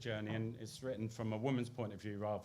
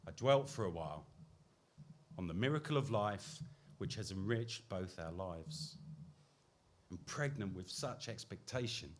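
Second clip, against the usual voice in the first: poetry reading; 3 semitones lower than usual. Acted. Poet reads second poem.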